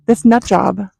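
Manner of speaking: normal talking voice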